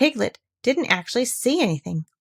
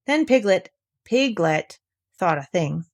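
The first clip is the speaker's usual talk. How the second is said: usual speaking voice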